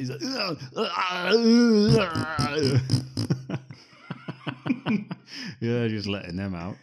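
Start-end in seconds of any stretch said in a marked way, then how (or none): 0.3-3.7 loud high drawn-out straining howl; straining noises. Retold or imitated. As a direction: groan and roar as if being held down. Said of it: "he's making farting noises".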